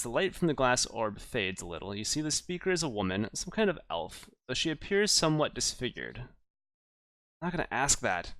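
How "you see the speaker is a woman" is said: ordinary talk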